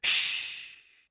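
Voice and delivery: urgent, voiceless hush; a long whispered shh; whispered shush. A narrator's reading. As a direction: shush them under your breath. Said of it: "James whispered back".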